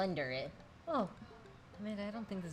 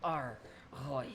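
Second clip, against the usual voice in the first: pirate talk; 3 semitones lower than usual. Pretend. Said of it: "talk like a pirate with me".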